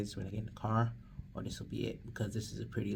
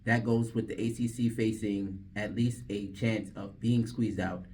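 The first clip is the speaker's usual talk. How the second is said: sports news read; 6 dB louder than usual. Real, not acted. DJ redoing the sports read, announcer voice.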